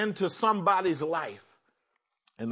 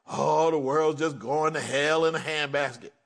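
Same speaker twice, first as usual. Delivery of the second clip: mocking gloom; 5 semitones lower than usual. Mocking a negative person.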